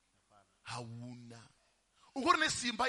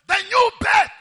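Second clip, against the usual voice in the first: shouted, slow and much higher; preaching at full force; 11 semitones higher, 22 dB louder than usual. Real, not acted. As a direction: thunder it out to the congregation.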